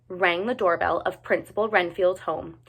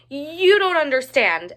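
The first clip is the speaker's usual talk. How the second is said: higher, urgent outburst; exclaiming; 6 semitones higher, 4 dB louder than usual. A narrator's reading. Ruby urging Johnny to take the danger seriously. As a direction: burst out insistently. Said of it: "exclaimed Ruby".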